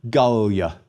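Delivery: Gallia as GAHL-lee-a; accent the first syllable of "Gallia", Latin-style; first-syllable stress. Shown as a demonstration. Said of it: "please repeat".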